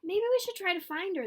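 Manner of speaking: put-on worried kids proposing a search; eager kids. A narrator's reading. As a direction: pitch it up eagerly. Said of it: "they said".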